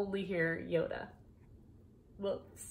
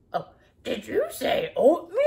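louder gravel-free monster put-on; Cookie Monster voice; 3 semitones higher, 8 dB louder than usual. Shown as a demonstration. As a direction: ask it like a hungry monster. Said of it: "It's my best Cookie Monster".

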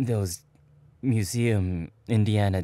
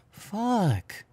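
embarrassed realization; 11 semitones higher than usual. Real, not acted. Realizing he misheard it.

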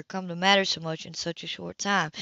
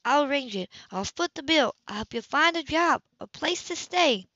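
higher, controlled and insistent; firm older sister; 7 semitones higher than usual. Acted. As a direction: lay out the terms steadily. Sybil's voice, pitched higher.

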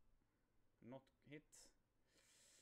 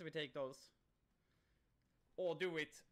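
ordinary delivery

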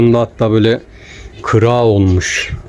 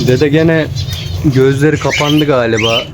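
calling a pet bird; 5 semitones higher than usual. Acted.

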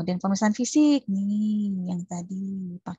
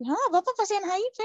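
much higher startled exclamation; 12 semitones higher than usual. Retold or imitated. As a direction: blurt it out in shock for everyone to hear. Nurse blurting diagnosis aloud.

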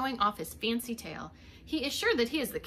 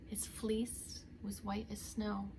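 soft slow whisper of a nursery line; whispered rhyme; 8 dB quieter, slower than usual. Acted. Reminding a child of the rhyme, slow whisper. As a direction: breathe the rhyme out slowly.

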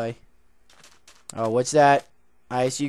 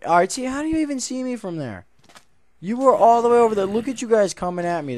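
high, loud, fast startled shout at the mobs; yelling at enemies who spotted him, high and loud; surprised call-out; 11 semitones higher, 9 dB louder, faster than usual. Real, not acted. Calling out to approaching mobs.

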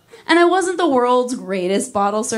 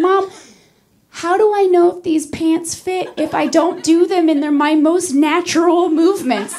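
mock kid; 9 semitones higher, 4 dB louder than usual. Kid self defending in store.

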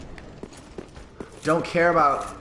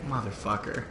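normal talking voice

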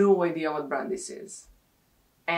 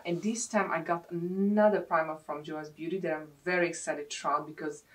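usual speaking voice

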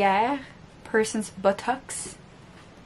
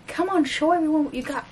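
quick, high pet voice; high-pitched talk to a pet; coaxing a cat; 6 semitones higher, faster than usual. Real, not acted. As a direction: urge the cat on, pitched up and fast.

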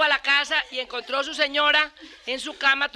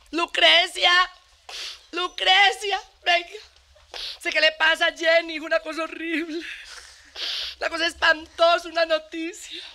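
mildly higher, eager gossip calling out; hammed-up gossipy neighbour; gossiping woman; 5 semitones higher than usual. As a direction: call her over, bursting with scandal. Gossip rushing to tell news.

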